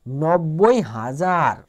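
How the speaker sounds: very slow, stretching each syllable of নব্বই হাজার; slow careful reading. Shown as a demonstration. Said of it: "teacher repeats number slowly".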